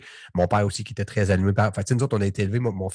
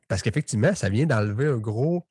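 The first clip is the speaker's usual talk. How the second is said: ordinary talk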